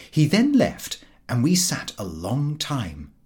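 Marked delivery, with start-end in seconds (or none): none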